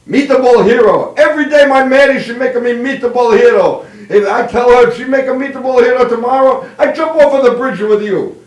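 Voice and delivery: put-on loud, high comic Italian accent; exasperated Italian man. Italian worker opens his lunch and threatens to jump, exasperated Italian accent. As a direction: complain like a hammed-up Italian worker. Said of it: "the Italian guy opens up".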